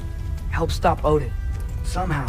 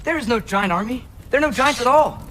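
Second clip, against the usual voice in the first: raised, higher voice, building; insistent outburst; 7 semitones higher, 4 dB louder than usual. Acted. Owning up to the truth about the giants, insistent. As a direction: insist louder on the second sentence.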